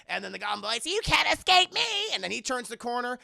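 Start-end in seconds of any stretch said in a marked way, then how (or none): 0.8-1.8 high, loud gloating taunt; taunting villain. Retold or imitated. Goblin boy chasing the heroes, loud high taunt. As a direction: gloat as you round the corner.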